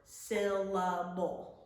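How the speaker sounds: syllable split into syl-la-ble, each part separate. Shown as a demonstration. Teacher counting chin drops, syllable said in separate beats. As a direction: break syllable into three beats. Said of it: "hand on our chin and we say the word".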